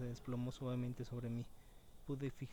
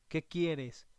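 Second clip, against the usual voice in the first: louder, challenging question; raised voice; 3 semitones higher, 8 dB louder than usual. A narrator's reading. Camper challenging the silent intruder outside his tent, raised voice. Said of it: "Ya había alzado la voz".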